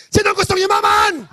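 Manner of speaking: loud, high rallying shout to the crowd; shouted question. Real, not acted. Speaker shouting to audience to spark a call-and-response. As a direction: call it out big to hype the room. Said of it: "pag sinigaw ko kung sinong gustong yumaman".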